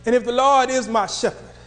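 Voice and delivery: ordinary delivery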